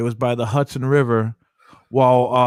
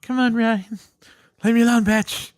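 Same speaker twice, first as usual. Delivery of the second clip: high-pitched mocking imitation; squeaky taunting girlfriend; high mock girl voice; 9 semitones higher than usual.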